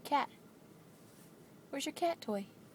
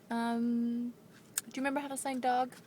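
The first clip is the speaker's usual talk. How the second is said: ordinary talk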